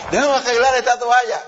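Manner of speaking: mujer de antes. Retold or imitated. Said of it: "la mujer decía".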